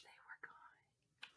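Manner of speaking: slow fading whisper. A narrator's reading. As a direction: let it vanish in a hush.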